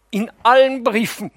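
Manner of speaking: high, loud breathless cry; gasping outcry. Acted. Theta gasps at the nephew about his letters, high and loud. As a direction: gasp it out in shock. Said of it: "keucht sie".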